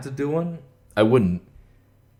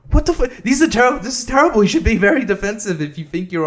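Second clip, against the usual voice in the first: loud, very high exasperated outburst; outraged; 10 semitones higher, 5 dB louder, faster than usual. Real, not acted. Vlogger objecting to the tip he read, outraged. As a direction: explode in indignant disbelief.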